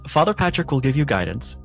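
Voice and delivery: ordinary talk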